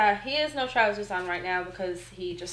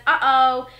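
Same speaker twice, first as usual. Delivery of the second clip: put-on loud, higher toddler-style exclamation; imitating toddler; 4 semitones higher, 10 dB louder than usual. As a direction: mimic a toddler, louder and lifted. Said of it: "He says".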